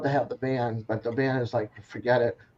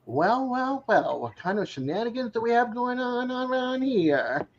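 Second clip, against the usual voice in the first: nosy sheriff voice; 7 semitones higher than usual. Retold or imitated. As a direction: play a nosy busybody catching mischief. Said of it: "It's like the".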